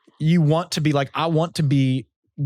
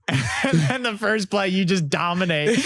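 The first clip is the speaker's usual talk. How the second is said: laughing through it; 5 semitones higher, slower than usual. Real, not acted. Laughing while telling the punchline.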